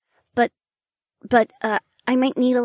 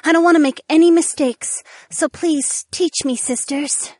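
high, eager pleading. A narrator's reading. Ariana begging the wives to teach her, pleading. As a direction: beg them earnestly, pitched up. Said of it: "Ariana pleaded".

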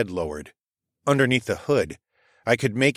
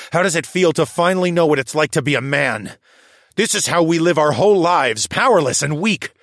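rising taunt; 7 semitones higher, 5 dB louder than usual. A narrator's reading. Hero taunting defeated aristocrat.

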